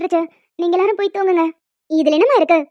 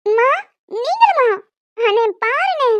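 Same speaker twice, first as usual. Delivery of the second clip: high-pitched, pleading sisterly whine; whining protest; 5 semitones higher, slower than usual. Acted. Teased sister complains to her mother and brother, whining. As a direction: appeal in mock hurt, pitch leaping up.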